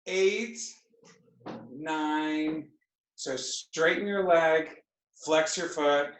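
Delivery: ordinary talk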